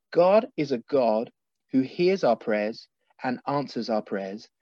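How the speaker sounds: ordinary delivery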